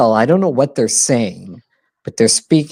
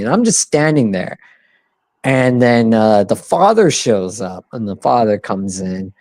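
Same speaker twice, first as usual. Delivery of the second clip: normal talking voice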